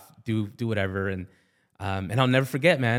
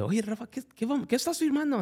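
mimicking his mom, pitched up and softer; a hint of a worried mother; mom's voice; 10 semitones higher, 6 dB quieter than usual. Mom asking dad what he's signing, pitched up.

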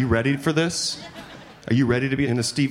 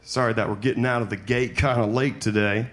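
ordinary talk

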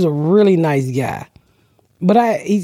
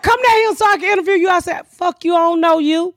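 much higher, lively quoting; raised-pitch excited storytelling; high excited retelling; 11 semitones higher than usual. Retold or imitated. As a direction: squeal it as you relive the moment. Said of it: "He's like".

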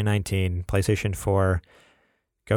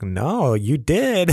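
insistent retort; 6 semitones higher, 4 dB louder, much slower than usual. Retold or imitated. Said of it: "I'm like".

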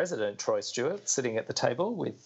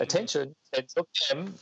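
a touch higher, announcing over a tannoy; lightly voiced intercom call; PA announcer; 5 semitones higher than usual. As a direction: page him like a base announcement.

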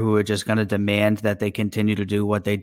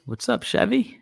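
high greeting; 12 semitones higher than usual. Real, not acted. Greeting a chat regular.

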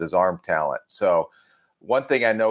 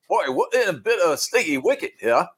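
hammed-up British accent, pitched much higher; mock British accent; 10 semitones higher than usual. Retelling his draft bit to co-hosts, hammed-up British accent. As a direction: put on a jokey British accent like a bad English toff. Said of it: "brought out my British accent".

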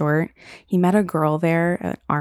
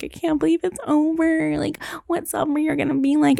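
higher, put-on crying voice; mock sobbing wail; 10 semitones higher than usual. Student crying alone in dorm room, high teary wail. Crying over leaving school.

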